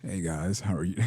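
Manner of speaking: low, smooth radio host voice. Pretend. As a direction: drop low into a mock radio-host greeting. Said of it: "give us your best radio voice".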